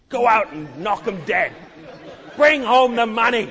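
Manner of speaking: loud, laughing hype-man boss barking orders; pushy corporate boss. Acted. Preacher plays a boss rallying workers, loud and laughing. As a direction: pump up the sales team like a brash boss, chuckling.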